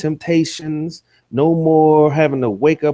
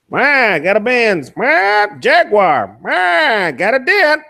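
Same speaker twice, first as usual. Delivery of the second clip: mock old salesman; 6 semitones higher, 4 dB louder, slower than usual. Mocking rival as car seller.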